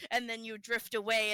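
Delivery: normal talking voice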